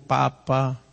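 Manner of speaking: ordinary delivery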